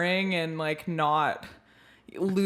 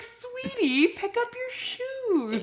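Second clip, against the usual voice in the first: much higher, slow, sugary mock-sweet parent; sweet mom voice; 14 semitones higher, 6 dB quieter, much slower than usual. Mom to her kid about shoes, mock-sweet high coo. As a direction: coo it like a saintly mother. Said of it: "That's not how you do it".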